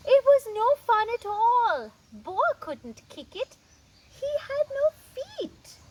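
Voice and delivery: high, loud exaggerated woe; mock dismay. A narrator's reading. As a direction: ham up Boa's disappointment. Sad birthday, reading to kids.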